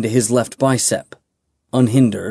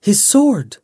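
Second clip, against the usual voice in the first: urgent call; 10 semitones higher than usual. A narrator's reading. Pointing out the sword.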